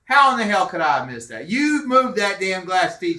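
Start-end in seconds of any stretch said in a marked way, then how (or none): 1.5-3.2 slow, loud, higher accusation; accusing. Retold or imitated. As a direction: accuse her, slow and raised. Accusing mother.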